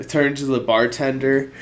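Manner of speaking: usual speaking voice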